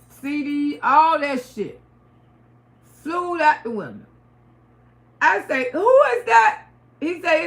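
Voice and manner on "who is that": high, loud challenge into the dark house; retelling how she yelled at the intruder, loud and high; loud call out. Retold or imitated. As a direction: call out sharply to whoever's there. Calling out at intruder.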